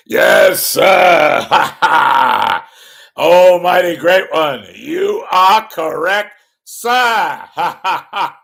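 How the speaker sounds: booming sidekick with big hearty laugh; Ed McMahon. Acted. Sidekick flattering the mighty great one, booming impression. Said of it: "thank you, Ed".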